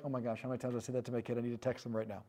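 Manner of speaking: quick, low, hushed aside; mock worried parent. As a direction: rush it under your breath like a parent's private thought. Voicing a worried parent's hurried aside.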